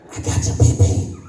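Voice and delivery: put-on whispered child's taunt. The son teasing his brother again, whispered. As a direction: breathe it out in a whisper. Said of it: "You can't whisper it either".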